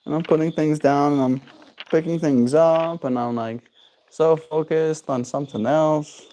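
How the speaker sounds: exaggerated innocent musing, high and light; pretending not to notice. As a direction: act distracted so the dog sneaks up. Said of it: "pretend like I'm not focusing".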